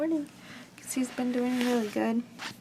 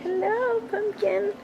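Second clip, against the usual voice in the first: much higher and louder sing-song hello; high greeting; 10 semitones higher, 3 dB louder, slower than usual. Real, not acted. Mother greeting her baby in the wagon.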